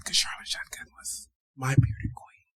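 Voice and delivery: breathy secret whisper. Real, not acted. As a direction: whisper it like gossip. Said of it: "Why are we whispering?".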